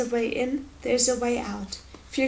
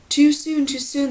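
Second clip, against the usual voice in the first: put-on complaining in a foreign accent, louder opening; accented complaint; 3 semitones higher, 6 dB louder than usual. A narrator's reading. Accented man objects to the coming war, complaining. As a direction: protest in the liquid foreign accent. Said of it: "the voice with the accent complained".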